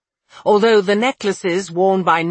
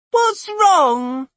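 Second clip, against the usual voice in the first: put-on very high little-girl voice; frightened child. A narrator's reading. Child runs over to the adults, high and worried. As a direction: ask as a worried small child.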